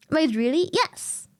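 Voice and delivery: ordinary talk, nothing marked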